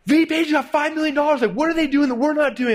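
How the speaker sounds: high-pitched imitated rant; Todd, all angry. Partner rants about a funded rival, mock angry. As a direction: mimic an outraged partner, pitch way up. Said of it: "little Todd is all angry".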